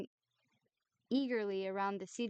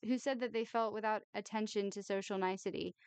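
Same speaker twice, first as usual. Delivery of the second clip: ordinary delivery, nothing marked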